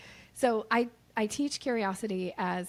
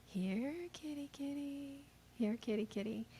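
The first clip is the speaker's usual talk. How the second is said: soft cat calling; 5 semitones higher, 7 dB quieter than usual. Shown as a demonstration. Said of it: "Cats will respond sometimes when you do the".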